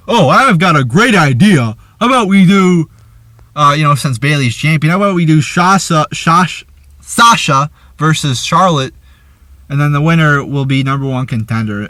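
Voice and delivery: mock Foley pitching a bright idea; imitating Mick Foley. Reviewer mocking Foley pitching a match idea. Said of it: "Mick Foley goes".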